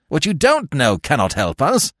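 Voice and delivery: high-pitched, impatient cut-in; irritable demand. A narrator's reading. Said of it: "he demanded".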